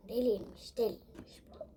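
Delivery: soft whispered complaint. Real, not acted. Wife complaining about husband, soft whisper. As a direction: hiss it quietly in disbelief.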